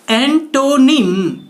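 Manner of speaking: drawn-out एंटोनिम with a round "o"; wrong pronunciation. Shown as a demonstration. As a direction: stretch एंटोनिम as "en-TOH-nim". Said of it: "एंटोनिम नहीं".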